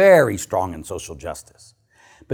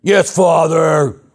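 slow, drawn-out shouted answer; imitating Justin; 5 semitones higher, 5 dB louder, much slower than usual. Student shouting an answer to the priest from the back, drawn out. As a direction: call back slowly from the back row.

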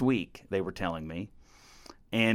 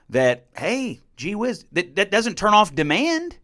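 ordinary talk

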